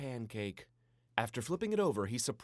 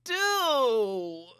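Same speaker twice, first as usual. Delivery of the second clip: much higher, slow, drawn-out whine; dramatic; 9 dB louder, slower than usual. A narrator's reading. Jack moaning to Papa about being stuck inside, dramatically. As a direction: ham up the boredom. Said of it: "Jack asked dramatically".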